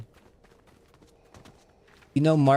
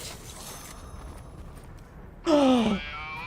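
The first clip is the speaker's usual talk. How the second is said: high gasp of sudden delight; excited gasp; 12 semitones higher, 6 dB quieter than usual. Real, not acted. Gamer finds the item untaken, high excited gasp. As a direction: gasp high, then let it fall. Sees nobody took the item.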